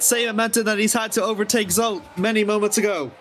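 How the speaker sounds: high, urgent race call; excited commentary. Real, not acted. Car sideways, live call.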